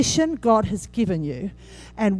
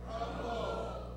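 whispered; 19 dB quieter than usual. Shown as a demonstration. Pastor leading the church in saying the word, whispered. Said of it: "Everyone say".

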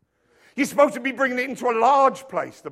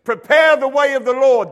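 proclaiming; 4 semitones higher, 10 dB louder than usual. Acted. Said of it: "John the Baptist stood there and he said".

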